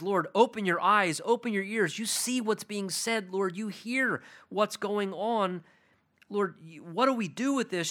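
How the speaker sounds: high, slowed, urgent plea; fervent pleading prayer. Acted. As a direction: beg God earnestly to look and listen. Paraphrased urgent prayer to God.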